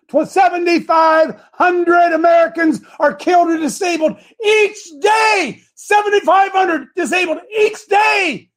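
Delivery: high, loud, slow shouted headline; fiery preacher shouting the headline; preaching at full force. Acted. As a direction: pound out every number.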